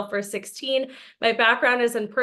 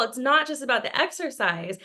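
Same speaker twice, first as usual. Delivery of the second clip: ordinary talk, nothing marked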